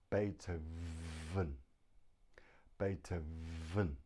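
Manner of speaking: Beethoven slowly, with a long buzzing "vvv" before the last syllable; drawn-out v. Shown as a demonstration. Teacher demonstrates teeth on bottom lip, Beethoven with the V held long. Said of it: "put your teeth on your bottom lip".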